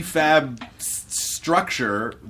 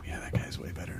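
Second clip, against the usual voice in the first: whispered, much softer; hushed awe; 14 dB quieter than usual. Retold or imitated. Interviewer whispers how Americans react to British accents. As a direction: breathe it like you're hypnotized. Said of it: "we immediately go".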